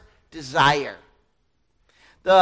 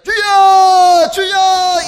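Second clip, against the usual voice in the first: full-throated shout, much higher and louder; screaming prayer; 10 semitones higher, 16 dB louder than usual. Retold or imitated. Youth group prayer leader shouting to God, full-voiced screaming. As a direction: holler it out like a fervent prayer leader. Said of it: "they're screaming and hollering".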